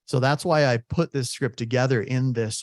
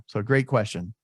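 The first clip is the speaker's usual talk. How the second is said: normal talking voice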